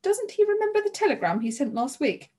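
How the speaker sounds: high, affronted question; indignant woman protesting; indignant exclamation. A narrator's reading. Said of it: "Missus Mendoza exclaimed".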